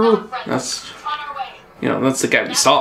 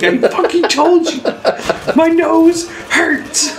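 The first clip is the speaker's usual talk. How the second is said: high, laughing, exasperated retelling; mock-whiny retold complaint, laughing; laughing exasperation; 14 semitones higher, slower than usual. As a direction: squeal it out through laughter, slowing at the end. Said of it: "I'm like".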